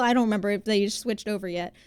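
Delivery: ordinary talk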